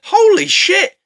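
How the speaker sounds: high, loud exclamation; mock shock. [name] discovering what the bug is, loud mock shock. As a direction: burst out in hammed-up astonishment.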